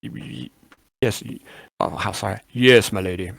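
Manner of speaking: quiet, halting stammer of a cowed servant; timid, lightly voiced butler; nervous manservant. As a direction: stammer softly, then manage a deferential answer. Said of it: "who looks terrified".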